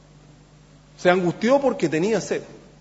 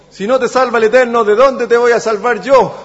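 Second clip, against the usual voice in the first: loud, raised-pitch mock reply; ironic retort; 5 semitones higher, 6 dB louder than usual. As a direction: play the king's scornful comeback. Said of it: "irónico, porque le dice". (réplica irónica del rey, en voz alta)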